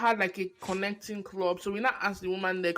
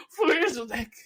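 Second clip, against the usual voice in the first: normal talking voice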